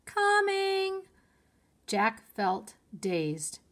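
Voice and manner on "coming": much higher, loud call out the window; shouting. A narrator's reading. Annie answers Mom from the treehouse window, shouting. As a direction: shout it back across the woods. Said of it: "Annie stuck her head out the window and shouted".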